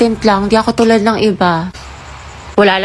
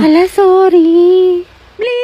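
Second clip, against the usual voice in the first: squeaky apology; 7 semitones higher than usual. Acted. Caught flirting, squeaky apology.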